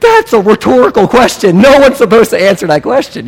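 furious coach impression. Coach to the player who answered him, mock furious. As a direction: imitate a coach blowing up. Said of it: "the coach is, like, kicking a trash can".

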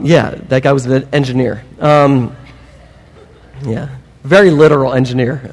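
normal talking voice

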